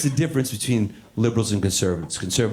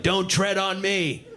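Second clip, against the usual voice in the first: mock defiant Republican; 8 semitones higher, slower than usual. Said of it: "Republicans are like".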